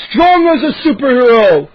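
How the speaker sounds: loud, high boastful roar; bellow. A narrator's reading. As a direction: bellow your own praises. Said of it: "he bellowed".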